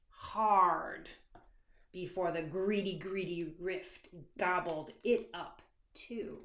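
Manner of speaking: usual speaking voice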